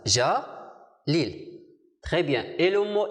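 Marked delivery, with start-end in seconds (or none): 0.1-1.5 Ja, then lil, with a clear break between syllables; split into syllables. Shown as a demonstration. Teacher to pupils, showing the two syllables, split apart. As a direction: clap out Ja and lil as two separate beats. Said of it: "composé de deux syllabes".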